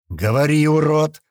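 loud furious command; angry shout. A narrator's reading. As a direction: bark at the prisoner angrily. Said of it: "зло сказал Лок". (злой окрик на пленника)

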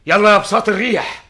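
loud eager command; commanding shout. Acted. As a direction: call the magic carpet loudly. Commanding the flying carpet.

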